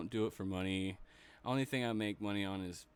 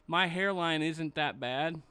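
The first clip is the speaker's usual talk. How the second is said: mocking whiny voice; 5 semitones higher, 4 dB louder than usual. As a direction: whine it like a pouty star. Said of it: "You don't go and see Sasha Banks going".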